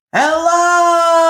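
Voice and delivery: full-voiced call across a valley; loud yell. A narrator's reading. Boy yelling across the valley, loud call. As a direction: shout it as loud as you can. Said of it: "yelled as loud as he could".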